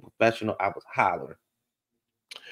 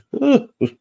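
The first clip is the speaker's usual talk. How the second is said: laugh; 7 dB louder than usual. Real, not acted. Laughing at the clip.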